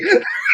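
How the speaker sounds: very high squeal of laughter; high giggle; shrieking laugh. Real, not acted. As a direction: shriek with glee.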